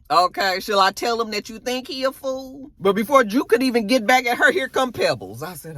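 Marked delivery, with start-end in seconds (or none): none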